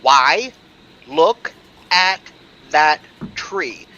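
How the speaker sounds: loud and exasperated; arguing loudly. Acted. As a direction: shout it in an argument. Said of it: "They argue on opposite sides of the path".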